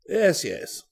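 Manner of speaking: a touch of impatience; impatient. Acted. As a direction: brush the question aside lightly. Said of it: "Waverley said almost impatiently".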